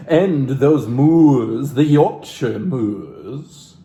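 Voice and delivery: very slow, savoring each word; drawn-out reverie. Acted. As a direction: stretch the moors out as if lost in them. Lingers dramatically over the moors.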